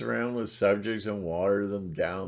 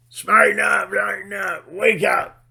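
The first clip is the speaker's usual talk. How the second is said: loud, high yelled command; barked high wake-up call; shouting; 11 semitones higher, 8 dB louder than usual. Real, not acted. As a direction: shout them awake. Yelling at viewers to wake up.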